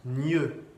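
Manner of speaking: "gneux" with a palatal gn. Shown as a demonstration. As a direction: say "gneux" like French GN. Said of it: "C'est comme un G N français". (« gneux », comme un G N français)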